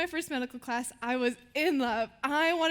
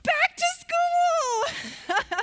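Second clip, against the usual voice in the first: very high, slow, drawn-out cheer; mock announcer cheer; 6 dB louder, much slower than usual. Asking the audience a question, stretched-out excited cheer. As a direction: sing it out like a big announcement. Game-show style announcement.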